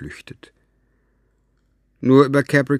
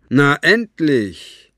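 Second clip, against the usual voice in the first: loud, slow, drawn-out call; 4 semitones higher, 6 dB louder, much slower than usual. A narrator's reading. Capricorn calling out as Buster finally arrives, loud and drawn out. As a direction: call down the steps with impatience. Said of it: "rief Capricorn".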